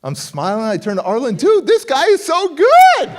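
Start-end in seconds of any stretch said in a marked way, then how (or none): 1.3-3.1 high, loud, slow starstruck gush; gushing admiration. Retold or imitated. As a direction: gush over the rival like a fan. Admiring opponent to friend.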